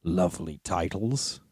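soft, slow sneer; mock the hobbit with a dragon's sneer. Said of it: "sneered the dragon".

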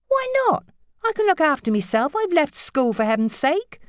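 very high, fast protest; teen girl arguing; indignant teen. Acted. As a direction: argue back at mother. Sammy voiced.

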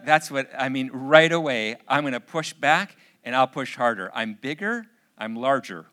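loud, forceful pushing back; combative inner voice. Retold or imitated. Father's inner reaction to daughter pushing, combative and loud. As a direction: act out a dad determined to overpower. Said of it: "This is what's going on in my head".

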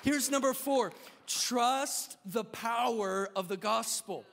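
loud, slow emphatic proclamation; preaching point. Acted. Preacher announcing his fourth point, slow and emphatic. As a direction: plant each word of the point.

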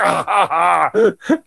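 slow, forced little laugh; awkward laugh. Retold or imitated. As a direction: laugh it off nervously. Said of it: "And I was like".

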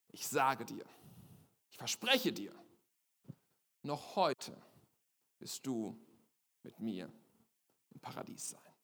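Jesus promising the criminal paradise, fading to a whisper. A narrator's reading.

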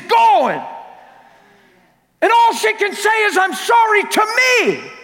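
high, loud, impassioned preaching; full-force preaching. Real, not acted. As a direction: preach it at the top of your voice.

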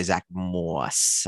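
Long Island vowel drawn out; Long Island accent. Pretend. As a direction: relish the name Long Island style. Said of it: "say Zach Moss in your Long Island accent".

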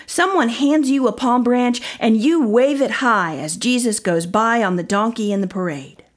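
plain reading voice

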